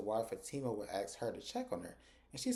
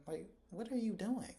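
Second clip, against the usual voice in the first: mock exasperation; 9 semitones higher, 4 dB quieter than usual. Vlogger scolds Zach, exasperated.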